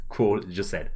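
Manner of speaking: usual speaking voice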